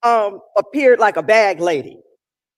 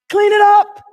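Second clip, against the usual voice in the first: shouted command; 10 semitones higher, 10 dB louder than usual. Shown as a demonstration. Repeated as a shout.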